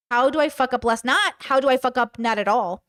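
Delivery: ordinary delivery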